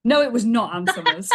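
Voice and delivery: laughing denial. Real, not acted. Guest denies the cheeky guess, laughing loudly. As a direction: deny it while laughing. Host jokes about Ann Summers kit.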